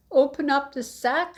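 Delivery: high, loud urgent call; shouted. Acted. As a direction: shout it from inside the sack. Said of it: "shouted Little Claus".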